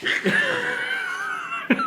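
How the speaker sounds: very high strangled squeal, held back; exasperated squeal. Real, not acted. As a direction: squeal with comic pent-up rage. Comic rage at the bad trailer.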